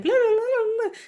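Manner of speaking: high and loud mock gibberish for fast Spanish; rapid Spanish babble. Waitress mimicking her own fast Spanish, high and loud gibberish. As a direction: rattle it off like speedy talk. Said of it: "it's so fast".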